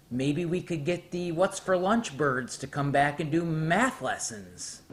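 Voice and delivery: ordinary talk, nothing marked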